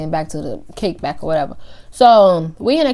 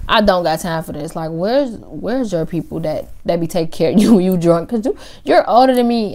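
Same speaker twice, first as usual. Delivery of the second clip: ordinary talk, nothing marked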